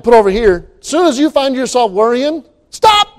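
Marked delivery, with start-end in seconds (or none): 2.7-3.1 very high yelled stop; shouted stop. Acted. Preacher yelling at his own worrying mind, a high loud stop. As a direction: shout it like slamming a brake.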